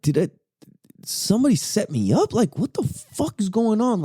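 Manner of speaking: high-pitched baffled retelling; utter bewilderment. Retold or imitated. Wondering if somebody set him up, high baffled disbelief. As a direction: pitch climbs as you act out confused questions. Confused thoughts during the raid.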